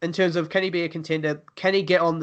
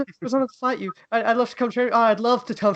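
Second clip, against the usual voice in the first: lightly voiced soft fighter, a bit higher; imitating soft-voiced fighter; 7 semitones higher than usual. Mocking fighter thanking his opponent after the fight, soft higher voice. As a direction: gently mimic his polite post-fight humility. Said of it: "he's got, like, a really soft voice".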